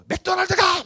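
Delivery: high, loud retort; mock mom snapping. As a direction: shoot it back like an irritated wife. Mom voiced snapping at dad. (남편에게 쏘아붙이는 엄마, 높고 크게)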